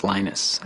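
"Linus" as LIE-nus, English style; English "Linus", rhyming with minus; English pronunciation. Shown as a demonstration. Programmer showing how to say his name, "Linus" the English way. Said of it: "When I speak English".

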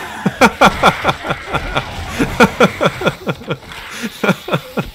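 long high-pitched laugh. Real, not acted. Laughs at own remark.

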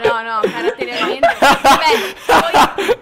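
laughing loudly while protesting. Real, not acted. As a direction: laugh it out, half embarrassed. Teased, bursts out laughing loudly.

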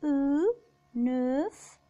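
"أو" held loud, then "نوف" likewise long; French "نوف" with a long rounded eu; lengthened EU. Shown as a demonstration. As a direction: stretch the vowel of "نوف". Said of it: "هو مجرد مد للحرف".

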